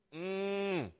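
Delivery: high, soft drawn-out "mm"; savoring hum. Real, not acted. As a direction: hum in appreciation. Savoring the verse just read.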